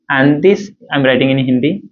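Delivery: normal talking voice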